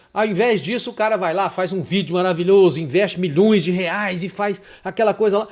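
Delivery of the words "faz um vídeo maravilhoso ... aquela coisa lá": ordinary delivery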